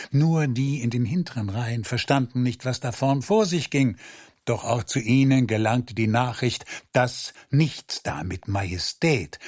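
ordinary delivery

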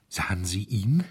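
panted out in a breathy half-whisper; breathless gasp. Acted. Anxious hotelier gasps at the chairman, breathless whisper. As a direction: gasp the question, barely voiced. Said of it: "keuchte der aufgeregte Hotelier".

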